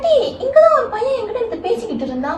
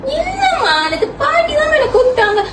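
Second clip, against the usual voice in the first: tearful insisting; 8 semitones higher than usual. Acted. Girl insists to mother, upset.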